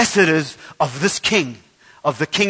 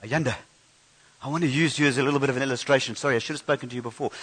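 quiet aside; 6 semitones lower, 10 dB quieter, much faster than usual. Real, not acted. Aside to a child during sermon.

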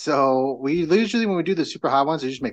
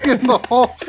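higher and louder, laughing through it; laughing while telling; 9 semitones higher, 6 dB louder than usual. Real, not acted. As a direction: crack up while telling the choking part. Recalls choking on pepper vapor.

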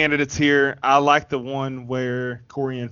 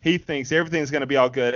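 ordinary talk

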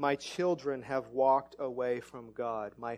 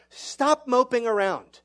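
mock scold; 7 semitones higher, 6 dB louder than usual. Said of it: "like what Paul says".

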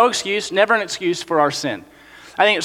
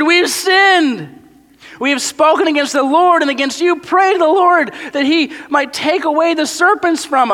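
higher, pleading voice; pleading Israelites; 8 semitones higher, 3 dB louder than usual. Acted. Israelites beg Moses to intercede, higher and pleading. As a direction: beg Moses to pray for them.

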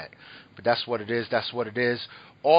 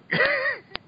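short high burst of laughter; giggle fit. Real, not acted. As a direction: lose it for a moment. Loses it again restarting intro.

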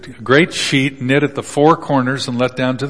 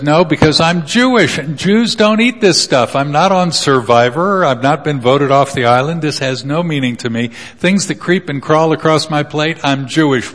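indignant Peter; 3 semitones higher than usual. Acted. Peter voiced refusing God.

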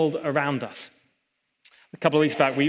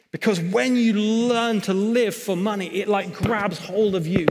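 rising, louder pulpit push; impassioned preaching; 4 semitones higher, 3 dB louder, slower than usual. Acted. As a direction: press the warning home with building intensity.

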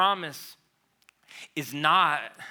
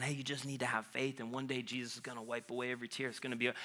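mock comforter; 9 dB quieter, faster than usual. Mocking a tactless comforter.

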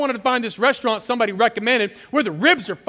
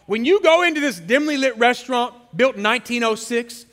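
ordinary talk, nothing marked

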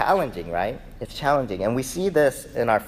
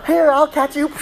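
loud, high mock coaxing; eager parent; 7 dB louder than usual. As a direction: coax like an overeager parent. Mock parents promising to catch child.

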